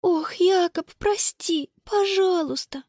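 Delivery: high, pleading regret; sighing apology. A narrator's reading. Sister begging her brother's forgiveness, remorseful. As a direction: apologise with a heavy sigh. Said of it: "Конни тяжело вздохнула".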